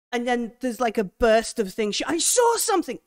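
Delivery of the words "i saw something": high, loud startled yell; spooked scavenger yelling out; frightened cry. Acted. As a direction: shout it out in alarm. Crew member cries out in fright.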